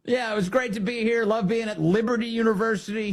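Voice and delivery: high mocking parroting of the guest. Host parroting the guest's thank-you, high and mocking. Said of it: "he says".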